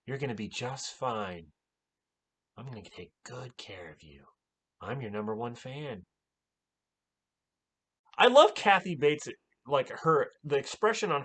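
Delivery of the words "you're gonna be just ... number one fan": soft creepy devoted fan voice; a hint of sweet menace; imitating Annie. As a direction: coo it like an obsessed nurse. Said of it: "She's like".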